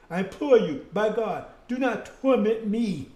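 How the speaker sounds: frightened demon imploring slowly; begging demon. Acted. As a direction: beg for mercy, drawing out torment. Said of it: "he started begging".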